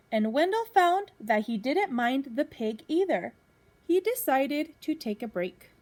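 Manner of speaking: lively storybook reading to a class; reading to children. Acted. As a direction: read it out like a teacher at story time. Teacher reads picture book to class.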